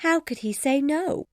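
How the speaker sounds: high, lightly amused question. A narrator's reading. Elizabeth teases the happy couple, amused high voice. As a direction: ask as if close to laughing.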